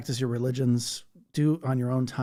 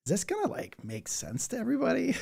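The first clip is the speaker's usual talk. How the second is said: higher-pitched, self-mocking worry; wondering self-doubt; 11 semitones higher, 4 dB quieter than usual. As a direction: voice your own doubt, pitched up. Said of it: "I always wonder it when I".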